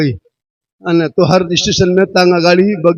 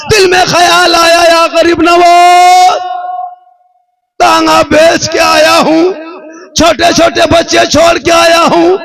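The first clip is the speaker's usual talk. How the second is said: high, loud, drawn-out wailing plea; a poor man's desperate prayer sung out high and loud; impassioned preaching; 11 semitones higher, 5 dB louder, slower than usual. Acted. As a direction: cry it out to the saint with full force. Preacher cries out a plea to Garib Nawaz.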